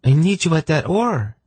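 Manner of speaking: high-pitched voice of an objecting woman. A narrator's reading. Senedra objecting to the rower leaving, high and insistent. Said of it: "she objected".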